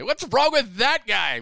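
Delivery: high, loud, drawn-out complaint; mock armchair-critic outburst about a player; indignant griping. Acts out griping about athletes.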